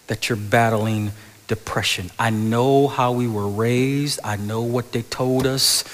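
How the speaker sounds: ordinary talk, nothing marked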